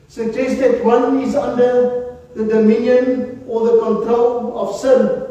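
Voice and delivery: ordinary talk, nothing marked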